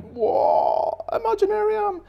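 breathy whoa then a very high Imaginarium; wonder. Pretend. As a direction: gasp and soar up like unveiling magic. Opening up the Imaginarium.

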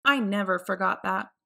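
ordinary delivery

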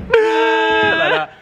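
very high, loud sustained wail; imitated wail. Retelling arriving at mum's house, high mock wail. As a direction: let out a mock bawling cry. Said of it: "she opened the door and I just went".

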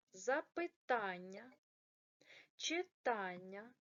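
"запитання", "читання" slow, stress on the "та" syllable; correct stress. Shown as a demonstration. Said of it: "як правильно". (повільно, з наголосом на "та": "запитання", "читання")

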